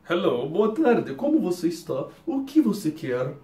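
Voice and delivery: slow, overly careful and formal; stiff textbook Portuguese. Shown as a demonstration. Teacher mocking the formal way nobody talks. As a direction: spell out every syllable like a textbook. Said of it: "Você não fala assim com ninguém".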